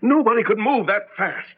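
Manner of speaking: rising, near-tearful panic; frightened disbelief. Acted. Husband panicking to his wife, frightened and rising. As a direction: voice climbing in fear.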